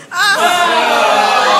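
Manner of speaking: loud high "Ah!" of disgust; a child's loud gross-out cry; mock disgusted yelp. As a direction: yelp like a kid seeing something gross. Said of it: "Lloyd leans over and goes".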